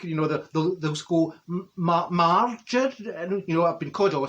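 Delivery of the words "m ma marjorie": halting, drawn-out mock attempt at a name; foreigner attempting a name. Mimicking foreign folk trying to say her name, halting. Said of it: "the foreign folk".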